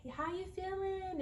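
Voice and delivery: very high, soft nurse imitation. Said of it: "the nurse was just like".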